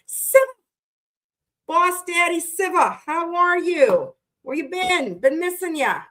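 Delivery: high, slow, drawn-out call; hyped greeting. Real, not acted. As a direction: greet him like a hype man. Hyped greeting to favorite viewer.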